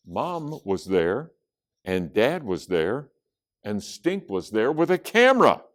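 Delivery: ordinary delivery, nothing marked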